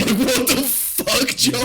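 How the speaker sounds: high, loud, drawn-out mock shock; laughing disbelief. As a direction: exclaim it incredulously through laughter. Retold shocked reaction to punchline.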